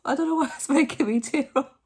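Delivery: high, loud laugh while tearing up; laughing through tears. Real, not acted. Telling viewers why the clip moves her, teary laughter. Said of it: "I'm just laughing because".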